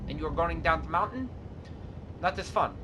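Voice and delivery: mock Soviet accent with articles dropped; put-on Soviet-style accent. Gamer narrating his truck driving to viewers, mock Soviet accent. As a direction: play the deadpan Soviet trucker, accent throughout. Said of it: "stop doing that accent now".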